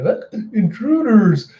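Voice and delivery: high, loud mock-drunk alarm; hammed-up drunken henchman raising the alarm; drunk goon. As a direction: sound a sozzled alarm, then cry out. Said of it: "you see the drunk Goonies going".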